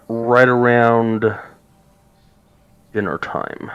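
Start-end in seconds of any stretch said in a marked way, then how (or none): none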